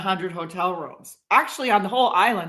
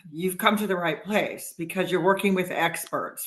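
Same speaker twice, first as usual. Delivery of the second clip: normal talking voice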